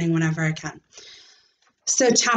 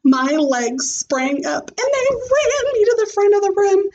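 excited; 12 semitones higher than usual. Acted. Excited child narration.

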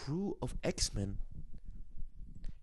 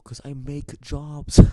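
loud punchline; 10 dB louder, slower than usual. Real, not acted. Punchline of his own joke.

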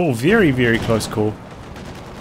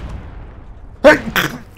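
sneezing; 12 semitones higher than usual. Real, not acted. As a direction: sneeze mid-commentary. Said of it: "Oh, excuse the sneeze".

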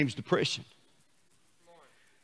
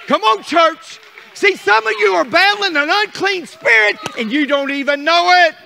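high, loud shouting preacher; preaching at full force; 11 semitones higher, 8 dB louder than usual. Acted. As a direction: shout it down at the devil.

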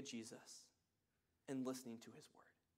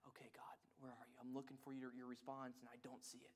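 man's near-whisper, much softer; 6 semitones lower, 16 dB quieter than usual. Retold or imitated. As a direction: whisper it, searching and waiting. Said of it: "He's like".

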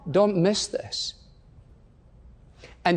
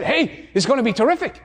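higher-pitched excited TV fan, acted; mock hype; 6 semitones higher, faster than usual. Everybody hyping a show about nothing, mock excitement. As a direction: talk up the show with mock enthusiasm.

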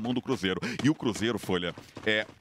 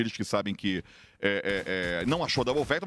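normal talking voice

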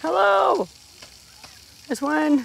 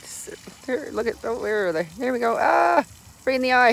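ordinary talk, nothing marked

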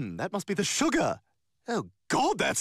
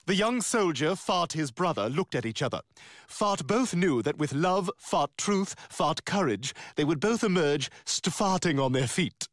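lower, solemn mock novel reading; mock dramatic reading; 4 semitones lower than usual. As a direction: read it like a grand war epic. Reading novel aloud mockingly.

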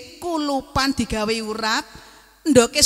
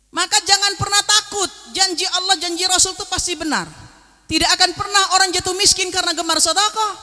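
raised, higher-pitched preacher's voice; preaching; 6 semitones higher, 3 dB louder than usual. Real, not acted. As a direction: preach a firm assurance to the crowd. Preaches at full force. (khotbah berapi-api dengan nada tinggi)

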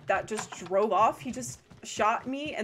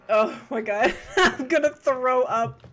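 loud, laughing after a fright; shaken laughter; 3 semitones higher, 6 dB louder than usual. Real, not acted. Streamer just startled in game, laughing and rattled. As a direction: get it out slowly between laughs, loudly.

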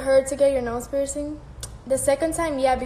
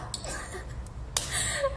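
high breathy laugh; 12 semitones higher, 11 dB quieter than usual. Real, not acted. Laughing at the comment.

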